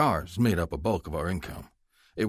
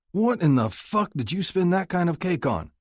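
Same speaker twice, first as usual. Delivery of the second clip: angry; 11 semitones higher than usual. Acted. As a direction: demand it furiously. Son angry at mother.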